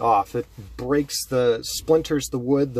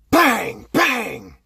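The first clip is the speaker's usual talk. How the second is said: high loud vocal bang; gunshot sound; 12 semitones higher, 8 dB louder than usual. Acted. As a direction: spit out the shots. Gunshot effects.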